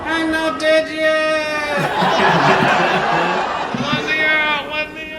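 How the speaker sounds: high, slow muffled voice from a box; high, slow trapped corpse voice; voice from a coffin. Retold or imitated. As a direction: call out as if trapped in a casket.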